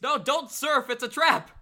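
high, loud frantic warning yell. Acted. Elagos warns his friend from the audience, high loud yell. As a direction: shout a desperate warning from the stands. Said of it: "Elagos is yelling from the audience".

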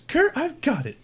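high, eager alien voice; high spider woman reassuring the captain; Kritka's voice. Acted. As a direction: pitch up, confident and keen. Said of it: "She says".

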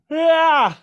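said through a big real yawn, high and loud. Real, not acted. Podcast host yawns mid-chat, talking through a yawn. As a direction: let the yawn stretch the word. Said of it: "I'm sorry for yawning".

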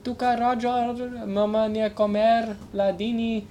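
high mom voice in mock foreign language; mom impression. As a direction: call him to dinner like his mother, with accent. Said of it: "That was my mom impression".

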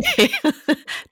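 short high giggle; laughing. Real, not acted. Host saying sorry for her joke, laughing. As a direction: giggle after an apology.

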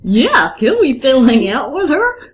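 swooping, goofy cartoon voice; Mickey Mouse. Trying a voice for Sonny's question, goofy cartoon voice. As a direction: bounce the pitch like a cartoon mascot. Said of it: "that one sounds like Mickey Mouse".

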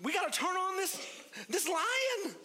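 rally the inner lion; high eager sports-dad hype; fired-up dad. Retold or imitated. Dad excited, voiced high.

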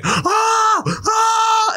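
high, loud excited yell; cheering as the ball leaves the bat. Retold or imitated. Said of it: "I start yelling".

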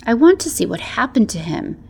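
ordinary delivery, nothing marked

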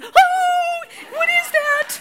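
a high, loud, startled squeal; startled shriek. Retold or imitated. Grandson shrieks at the eyelash he pulled off, high squeal. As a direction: shriek like a spooked little boy. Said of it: "he's freaking out".

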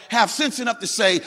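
normal talking voice

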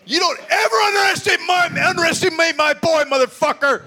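high shouted gloat at the fair guy; proud dad yelling at the carnie; imagined triumphant yell. Retold or imitated. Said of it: "None of that happened. I didn't say any of that".

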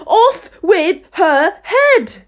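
slow, high, loud royal scream; raging queen's death sentence; furious shouting. A narrator's reading. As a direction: shriek each word in a furious passion. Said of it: "stamping about and shouting".

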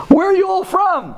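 put-on high, emphatic Brooklyn accent. Landlord surprises the visiting guys with his Brooklyn accent. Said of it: "He was talking with a Brooklyn accent".

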